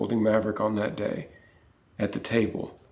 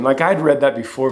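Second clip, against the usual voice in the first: usual speaking voice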